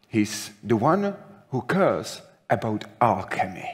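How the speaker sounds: ordinary talk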